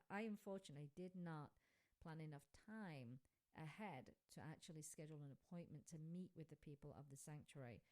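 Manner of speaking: usual speaking voice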